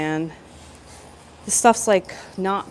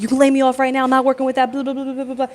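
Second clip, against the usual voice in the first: higher-pitched, run-on outburst; mock defiant rant; 6 semitones higher, 3 dB louder, faster than usual. Apprentice daring foreman to lay her off, mock worked-up rant. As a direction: replay a worked-up ultimatum to the boss.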